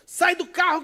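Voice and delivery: high, loud imitated command; retold robber's shout. Robber ordering the driver out of his car, imitated shout.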